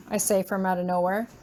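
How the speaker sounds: ordinary delivery, nothing marked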